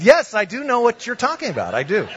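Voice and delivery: high, eager-to-please reply; over-eager agreement. Retold or imitated. Pastor humours the intimidating mobster, over-eager agreement. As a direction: agree too readily, pitched high. Nervous preacher answers the mobster.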